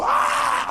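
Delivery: very high breathy gasping shriek; a sudden panicked gasp; startled yelp. Retold or imitated. Driver skidding toward the wall, yelping in panic.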